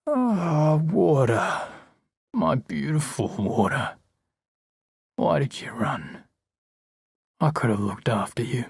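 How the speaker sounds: a thin, slow, grieving voice, drawn out; thin and low. A narrator's reading. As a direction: Ramage mourning over Rose, a thin low voice. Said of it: "it's thin and low".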